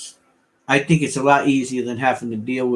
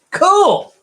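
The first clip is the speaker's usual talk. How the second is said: high, loud cool; excited; 8 dB louder than usual. Real, not acted. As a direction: pop it out with a burst of excitement. Sudden loud exclamation.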